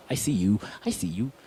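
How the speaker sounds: soft, low acknowledging murmur; quiet, low I see you. Shown as a demonstration. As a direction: say it gently, as if truly seeing them. Showing engaged attention to someone.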